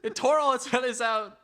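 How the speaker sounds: high, hammed-up frantic excuses; mock defensive. Sitters explain naked parrot to owner, hammy high pleading. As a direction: protest innocence in a high put-on voice. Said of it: "And they're like".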